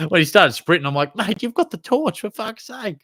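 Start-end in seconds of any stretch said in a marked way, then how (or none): 1.2-3.0 exasperated mock shout. Calling after sprinting student.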